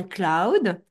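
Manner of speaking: French speaker's modest English accent. Shown as a demonstration. Host warns guests about the word cloud, English accent. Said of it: "attention à mon accent anglais".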